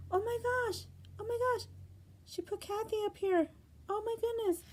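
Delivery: high-pitched real gasp of delight and amazement; excited, touched. Real, not acted. Vlogger discovers Kathy up on the card, high and overjoyed. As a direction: squeal it high, thrilled by the surprise.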